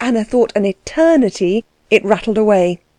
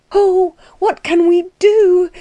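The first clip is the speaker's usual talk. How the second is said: much higher, slow desperate wail; frightened cry; 11 semitones higher, slower than usual. A narrator's reading. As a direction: cry out in helpless panic. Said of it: "she cried".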